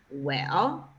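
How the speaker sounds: "Well" dipped low then rising; thinking well. Shown as a demonstration. Teacher showing the third tone as a thinking sound. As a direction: let "Well" dip low and come back up, pondering. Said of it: "it's a thinking sound".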